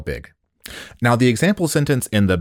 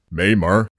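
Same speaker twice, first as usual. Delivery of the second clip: ordinary talk, nothing marked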